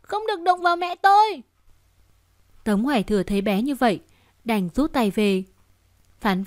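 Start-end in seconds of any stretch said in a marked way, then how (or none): 0.0-1.4 put-on high, shrill-pitched scared child shout; a little girl defending her mother; frightened child cry. Frightened daughter warning the father away, high child cry. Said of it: "bị sợ hãi kêu lên".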